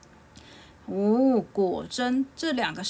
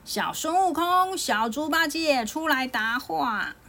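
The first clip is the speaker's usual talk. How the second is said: high, stretched summons; herald's high call, slowing at the end; 7 semitones higher, 3 dB louder than usual. A narrator's reading. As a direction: hail them from up on a cloud. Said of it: "云上有人喊着".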